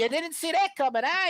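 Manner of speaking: high, breezy mock-hero quip; casual Kraven. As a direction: toss it off lightly, high-pitched. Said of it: "he's just kinda like".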